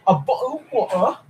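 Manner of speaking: high British bottle of water, t's swallowed; playful British meme voice; mock British accent. Streamer mocking British speech for chat, high and playful.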